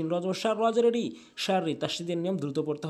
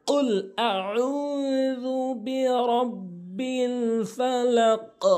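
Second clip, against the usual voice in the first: slow, high chanted recitation; Quran recitation; 7 semitones higher, 3 dB louder, much slower than usual. Shown as a demonstration. Teacher recites the verse slowly as the model. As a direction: intone the verse slowly and melodically. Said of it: "এভাবে উচ্চারণ করবেন".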